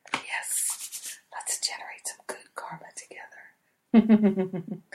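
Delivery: real hushed whisper; whispering, barely holding in excitement; excited whisper. Real, not acted. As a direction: whisper it like an eager secret. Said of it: "I don't know why I'm whispering".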